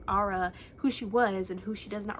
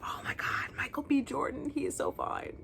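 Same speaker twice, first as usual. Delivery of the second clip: whispered, higher-pitched swoon over a celebrity; swooning over a crush; 6 semitones higher than usual. Retold or imitated. Fan gushing to viewers about her celebrity crush, whispered swoon. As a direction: gush it in a hushed, lovestruck voice. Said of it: "I was like".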